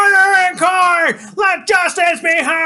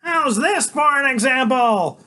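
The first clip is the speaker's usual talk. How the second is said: bold proclamation; 8 semitones higher, 5 dB louder than usual. Acted. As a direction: proclaim it like a triumphant hero. Freak proclaiming from shoulders.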